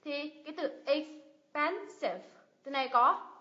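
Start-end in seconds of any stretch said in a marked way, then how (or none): 0.9-2.2 expensive slowly broken into 'ex, pen, sive'; slow, spaced teaching demo of expensive; three separate syllables. Shown as a demonstration. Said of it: "ba âm tiết".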